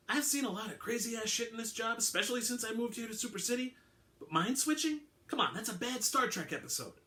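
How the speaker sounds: mock the whole idea as a bad TV plot; sceptical detective. A narrator's reading. Alvarado voiced in audiobook.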